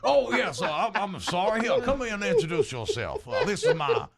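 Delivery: loud, high Southern apology; excitable, amused Southern host; flustered Southern gentleman. Acted. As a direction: apologise effusively as a Southern gent.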